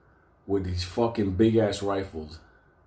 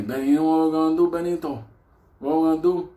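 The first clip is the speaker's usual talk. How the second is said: high, loud, fast urging; hammed-up eager old man; 7 semitones higher, 5 dB louder, faster than usual. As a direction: pump Benny up. Hector to Benny.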